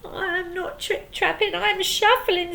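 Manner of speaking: very small voice. Acted. Scared troll answering the pirates in a tiny voice. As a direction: shrink your voice down to nothing. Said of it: "said the troll in a very small voice".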